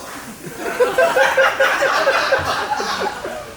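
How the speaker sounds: airy high-pitched giggle; high breathy laugh. Real, not acted. Comic cracking up after the punchline, laughing. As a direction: crack up at your own line.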